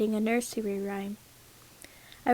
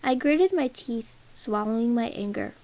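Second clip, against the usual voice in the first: usual speaking voice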